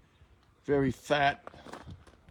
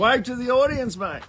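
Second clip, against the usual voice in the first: calling out; 11 semitones higher, 8 dB louder than usual. Real, not acted. Calling out to a distant man.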